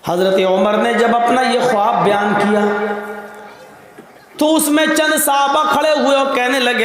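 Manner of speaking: normal talking voice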